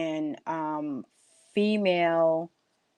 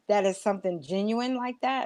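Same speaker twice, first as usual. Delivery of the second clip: ordinary delivery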